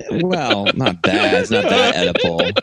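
amused protest through laughter; real laugh breaking into the words. Real, not acted. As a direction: object while cracking up. Laughing at a teasing joke.

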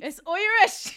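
loud, high mock-Irish insistence; hammed-up Irish "oy-rish"; fake Irish accent. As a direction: shout it in a badly faked Irish accent. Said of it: "It's not Irish".

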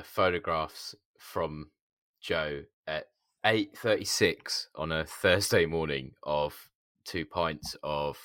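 normal talking voice

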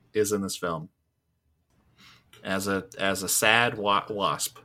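ordinary delivery

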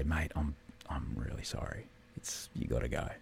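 a hushed, kind whisper breaking bad news; a quiet apologetic whisper to someone you're sending home; soft whisper. Shown as a demonstration. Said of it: "The whispering is like".

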